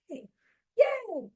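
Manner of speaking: high, gleeful little yay; excited cheer. Real, not acted. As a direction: cheer it high, without shouting. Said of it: "I'm so excited".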